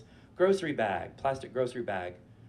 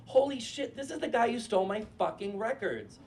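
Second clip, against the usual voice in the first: high, quicker second holy shit; a fast high burst of disbelief; shocked recognition; 9 semitones higher than usual. Retold or imitated. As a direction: snap into shocked realization. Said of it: "and I was like".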